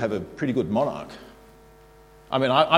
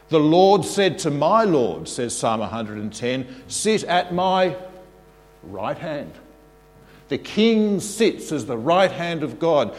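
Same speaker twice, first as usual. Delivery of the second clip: solemn scripture proclamation; 3 semitones higher, 3 dB louder, slower than usual. Acted.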